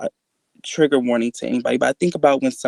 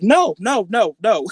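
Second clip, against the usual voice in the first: high, loud string of refusals; retold frantic protest, pitched up; panicked refusal; 9 semitones higher, 4 dB louder than usual. Refusing mom's idea of going inside, panicked.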